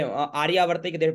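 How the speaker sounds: normal talking voice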